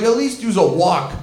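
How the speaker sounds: ordinary talk, nothing marked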